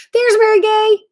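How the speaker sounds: high, loud happy call-out; light up at seeing her name; excited greeting. Real, not acted. Host greeting a viewer who just joined, excitedly. Spotting a friend in chat.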